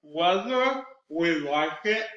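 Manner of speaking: slow, each syllable drawn out around the fingers; with fingers in mouth, very slow. Shown as a demonstration. Cork work tongue twister with fingers in the mouth. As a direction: articulate every syllable slowly. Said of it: "two more times with fingers in the mouth".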